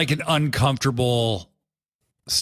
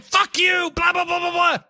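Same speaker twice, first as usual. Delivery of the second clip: mock shouted insult. Hangman and Swerve trading mock-shouted insults. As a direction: rattle off the yelling in a mock voice.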